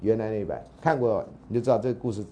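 normal talking voice

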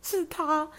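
高声拉长的"哦"，慢慢认出来; 心里一惊，认出他的声音; 恍然惊讶. Retold or imitated. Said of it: "然后我就想说". (高而慢地惊讶)

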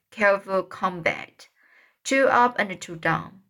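plain reading voice

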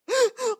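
short burst of high, loud laughter. Real, not acted. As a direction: burst out laughing mid-story. Laughs at sister's prediction. (忍不住大笑)

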